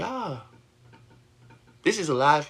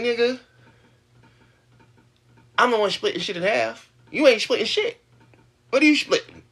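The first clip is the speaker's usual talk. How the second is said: heated retort; 8 semitones higher, 3 dB louder than usual. Retold or imitated. Retold argument with him.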